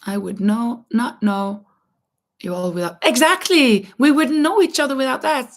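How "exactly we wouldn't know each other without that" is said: loud, high, excited agreement. Real, not acted. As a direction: burst out in eager agreement.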